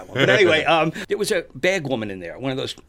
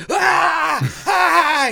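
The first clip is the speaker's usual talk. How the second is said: much higher, loud terrified shouting; panicked scream; 7 dB louder than usual. Retold or imitated. Rude guy suddenly attacked in his seat, loud scream. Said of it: "the guy goes".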